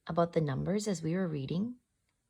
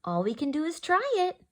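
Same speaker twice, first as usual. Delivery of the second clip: small, higher voice; tiny voice; 9 semitones higher, 4 dB louder, faster than usual. Acted. Ruby agreeing to go, in a small higher voice. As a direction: say it small and unsure, pitched up. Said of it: "she said in a tiny voice".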